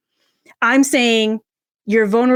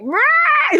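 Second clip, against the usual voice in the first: sobbing wail. Retold or imitated. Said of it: "And I cried. I was like".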